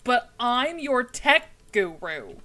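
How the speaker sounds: mock-protesting, slow and emphatic; Wolf. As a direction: punch each word like an offended expert. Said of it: "Wolf was just like".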